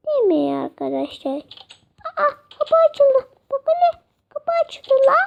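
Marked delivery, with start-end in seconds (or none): 2.2-5.1 high surprised exclaim. Real, not acted. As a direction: act amazed the door opened. Surprised door opened. (yüksek sesle şaşırmış)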